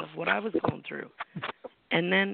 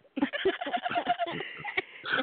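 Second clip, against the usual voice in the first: laugh. Real, not acted. Laughs about escaping the house.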